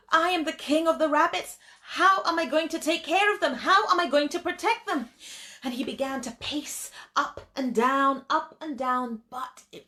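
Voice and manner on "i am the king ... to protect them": higher, louder and fast, fretting over his rabbits; a panicky king asking himself what to do; worried lion king. Acted. The lion king frets aloud about protecting his rabbits, anxious and fast.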